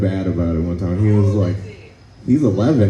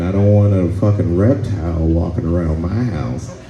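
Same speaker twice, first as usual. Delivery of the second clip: ordinary talk, nothing marked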